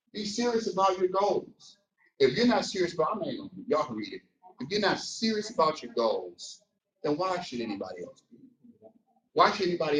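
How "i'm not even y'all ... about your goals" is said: usual speaking voice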